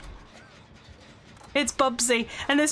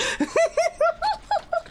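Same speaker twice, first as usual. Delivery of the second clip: high-pitched laugh; high giggle. Real, not acted. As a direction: burst out giggling. Laughing at teammate.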